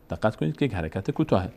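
usual speaking voice

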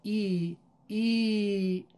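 ای drawn out to double length; doubled, elongated ای; long ee vowel. Shown as a demonstration. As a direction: stretch ای long and even.

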